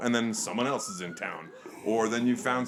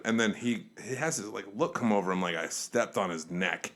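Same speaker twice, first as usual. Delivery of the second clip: normal talking voice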